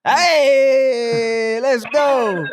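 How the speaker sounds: loud, high, drawn-out hey; excited streamer hype yell; hyped cheer. Real, not acted. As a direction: cheer them on with a long, rising shout. Streamer hyping up a passer-by.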